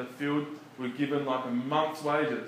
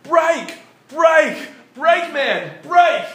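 full-throated, high, much louder shouted commands; imitated yell; 15 dB louder, much slower than usual. English preacher yelling at the crowd to break, imitated shout. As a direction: bellow it like a preacher into a microphone. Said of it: "He'd yell".

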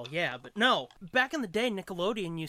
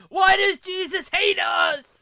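much higher, louder, slow mock lament; mock wailing kid; 12 semitones higher, 11 dB louder, slower than usual. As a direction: drag it out like a howling child. Mock son's wail.

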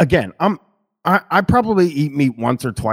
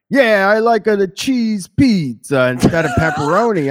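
put-on a quick, half-hearted New York accent, pitched higher; mock New York accent; 6 semitones higher than usual. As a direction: tease a New Yorker with a light New York accent. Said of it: "'cause I can't do accents".

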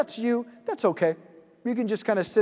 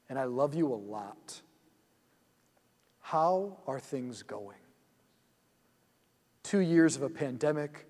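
soft, slow meditation voice, lower and quieter; guided meditation; 3 semitones lower, 3 dB quieter than usual. Acted. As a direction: lead them gently into the scene, unhurried.

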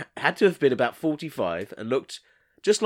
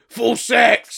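loud, higher acted yell; 8 semitones higher, 7 dB louder than usual. Customer yelling at the store clerk, yelling. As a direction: yell it out like an urgent demand. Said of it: "this time yelling".

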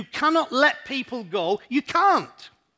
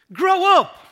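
louder and higher, scolding; sharp rebuke; 6 semitones higher, 5 dB louder than usual. Acted. Preacher scolding the uppity congregation, loud rebuke. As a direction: snap it at them like a stern parent.

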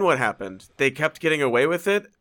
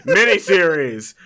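loud, slow trailer-announcer boom; 4 semitones higher, 6 dB louder than usual. Pretend. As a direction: proclaim it like an epic movie trailer. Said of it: "a booming voice saying".

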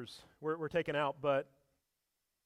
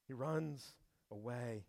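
softer, slow, letting it sink; hushed repeat; 6 semitones lower, 10 dB quieter, slower than usual. Shown as a demonstration. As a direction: drop the voice and slow down. Repeated line, second hushed.

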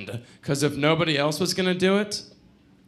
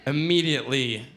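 ordinary delivery

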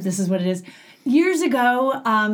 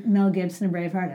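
ordinary delivery

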